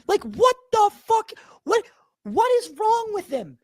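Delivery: stunned disbelief. Retold or imitated. Host right after the guest storms off, stunned disbelief. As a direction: let the shock spill out, word by word.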